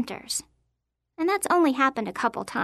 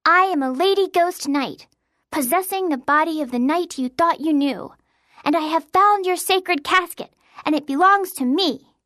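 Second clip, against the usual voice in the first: higher, louder, imperious declaration; proclaiming ghost knight; 4 semitones higher, 5 dB louder than usual. A narrator's reading. Girl challenges her friend as a lady ghost knight, grand proclamation. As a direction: claim the treasure like a play-acting phantom.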